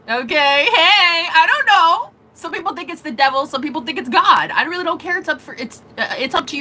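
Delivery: getting fired up about the boss voice; excited. Real, not acted. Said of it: "excited aside".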